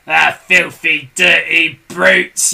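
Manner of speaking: loud, slow disgusted growl; Mr Twit yelling. A narrator's reading. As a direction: spit the words out angrily. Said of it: "yelled Mister Twit".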